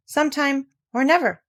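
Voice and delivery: ordinary delivery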